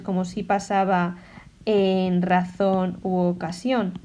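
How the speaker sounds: ordinary talk, nothing marked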